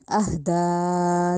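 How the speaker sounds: अहदा chanted slowly, da held long; lengthened recitation. Shown as a demonstration. Teacher models the long vowel for pupils, slow chant. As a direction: hold the da of अहदा. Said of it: "इसको ज़्यादा लंबा करना है".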